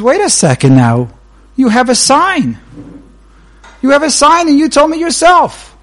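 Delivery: loud, rising indignant complaint; indignant protest. Acted. Customer protests to the tailor about his bill, indignant.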